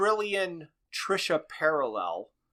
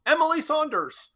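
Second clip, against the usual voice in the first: sudden mock yell, pitched way up; imitated yell; 11 semitones higher than usual. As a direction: blurt the name out high, imitating a yell. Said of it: "and he yells out".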